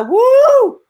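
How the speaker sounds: loud, high whoop of joy. Real, not acted. Cohost cheers that Kathy didn't die, loud high whoop. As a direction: cheer like a crowd went wild. Celebrating Kathy being alive.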